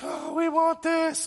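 high, slow whining mock voice; hammed-up whiny complaint; whiny Israelites. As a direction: whine like a spoiled child. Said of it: "and they're like".

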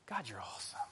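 soft, low, hushed praise; reverent. Shown as a demonstration. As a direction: say it quietly in awe, not flippant. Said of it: "but you could also say".

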